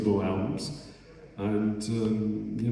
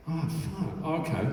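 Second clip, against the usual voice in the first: ordinary talk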